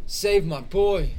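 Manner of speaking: high, loud, slow begging; desperate plea. Acted. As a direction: plead for the boy's life. Grieving father figure held.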